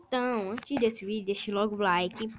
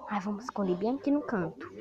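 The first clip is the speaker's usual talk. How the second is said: normal talking voice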